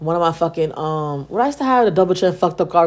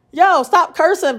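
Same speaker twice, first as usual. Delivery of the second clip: retold scolding; 10 semitones higher, 3 dB louder, slower than usual. Mother scolding child for cursing.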